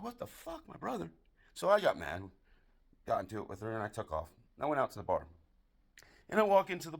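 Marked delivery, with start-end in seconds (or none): 0.0-1.1 high-pitched shocked outburst, kept soft; high, hushed what-the-hell reaction; shocked disbelief. Retold or imitated. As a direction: react in squeaky, incredulous disbelief. Shocked reply to a betrayal.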